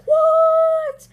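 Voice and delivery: very high, loud drawn-out shriek of "what"; stunned reaction. Retold or imitated. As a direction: re-enact jaw-dropping shock, stretched right up high. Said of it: "then my mouth was like".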